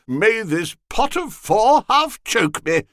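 high, loud tipsy jeering; a sozzled rogue ribbing his friend; drunken lout. A narrator's reading. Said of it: "a low fellow who was fuddled".

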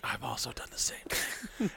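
a hushed, guilty confession; guilty whisper. Retold or imitated. Friend silently admitting he did the same, whispered. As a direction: let it slip as a secret.

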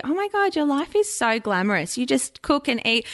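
high gushing mock awe; gushing admirer. Followers imagining her glamorous life, high gushing mock awe. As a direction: gush like an envious fan. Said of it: "people see".

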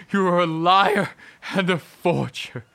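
high, loud accusation; furious shout. Acted. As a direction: spit it at her. Husband rages at wife.

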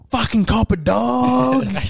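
high, drawn-out mock yell; retold heckle. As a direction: call it out at the cops, stretching every word. Re-enacting own heckle at police.

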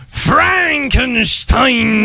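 very slow drawn-out name; dramatic reveal. Acted. Host announces Halloween topic, slow and dramatic. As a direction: stretch the name out slowly for suspense.

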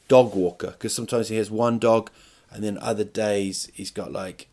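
usual speaking voice

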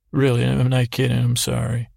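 normal talking voice

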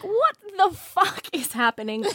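high, slow, hammed-up disbelief; mock-shocked station hand; acted bewilderment. Station hand shocked by famous outlaw.

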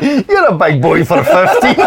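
laughing conductor. Retold or imitated. Conductor doubting the boy's age.

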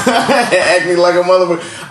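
slow, laughing through the words. Real, not acted. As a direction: laugh it out while admitting it. Laughing about acne.

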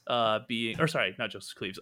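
ordinary delivery

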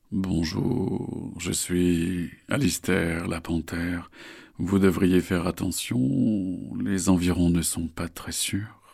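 slow, sly panther voice; menacing big cat, taking its time; slow ominous raspy drawl. Acted. Panther Alistair introduces himself.

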